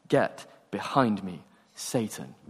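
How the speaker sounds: ordinary delivery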